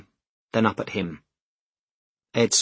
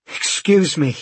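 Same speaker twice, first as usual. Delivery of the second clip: higher, breathless interruption; breathing hard; 6 semitones higher, 4 dB louder, slower than usual. A narrator's reading. Breathless man to official at desk, breathing hard. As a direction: cut in while catching your breath. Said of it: "breathing hard".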